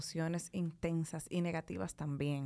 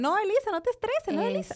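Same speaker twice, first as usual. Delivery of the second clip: high-pitched, louder imitation of unhelpful comforting; mock dismissive reassurance; 12 semitones higher, 5 dB louder than usual. Friend telling stressed Elisa not to stress, mock dismissive reassurance. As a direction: wave the stress away in a raised voice.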